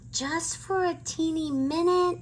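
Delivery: high, sweet coaxing voice; pleading little girl. A narrator's reading. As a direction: wheedle like a little sister. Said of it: "said Annie".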